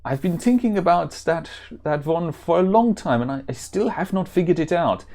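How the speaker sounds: put-on German-accented warrior; hammed-up German accent. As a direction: play it as an earnest German hero. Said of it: "that accent that I used".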